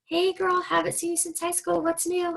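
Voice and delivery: put-on girly greeting; small-talk opener. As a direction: imitate a forced, high-pitched catch-up greeting. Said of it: "feel awkward talking and being like".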